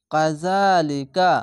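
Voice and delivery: ইউকাতিলুকুম recited slowly in a chanting Quran voice; recitation voice. Shown as a demonstration. Teacher to students, reciting slowly for practice. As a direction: chant ইউকাতিলুকুম with long vowels. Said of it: "এক আলিফ টেনে পড়বেন".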